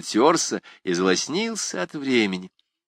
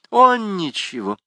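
flustered, higher and slower denial; embarrassed; 10 semitones higher, 4 dB louder than usual. A narrator's reading. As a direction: brush it off in embarrassment. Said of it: "смутившись". (смущённо)

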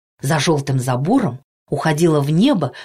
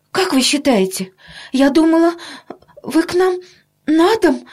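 high-pitched, halting, anxious pleading; flustered mother; 12 semitones higher than usual. A narrator's reading. Mother begging the doctor for a home visit, flustered. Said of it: "растерялась мама".

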